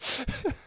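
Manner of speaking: short high burst of laughter; real laugh. Real, not acted. Teacher cracking up at a viewer's comment, real laugh.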